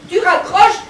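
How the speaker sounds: gronde fort et lentement; sermon furieux. Acted. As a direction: ordre sec, en appuyant. Mother scolds aunt on phone. (gronde sa tante au téléphone, furieuse)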